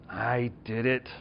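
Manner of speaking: slow grudging confession, teeth clenched; through gritted teeth. Retold or imitated. Son grudgingly confesses to his dad, through gritted teeth. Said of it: "with gritted teeth".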